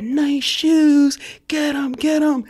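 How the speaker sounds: high-pitched mock jeer; jeering pursuers. Cops chase painter down the block, jeering retold shouts.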